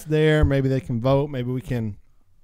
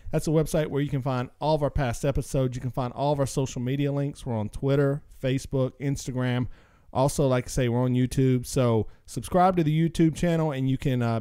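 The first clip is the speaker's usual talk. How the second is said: usual speaking voice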